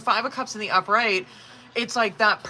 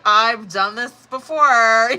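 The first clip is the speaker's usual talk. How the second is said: slow, louder tired sigh of a line; weary exasperation; 2 semitones higher, 7 dB louder, slower than usual. Retold or imitated. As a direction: drag it out, fed up. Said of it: "'Cause it's like".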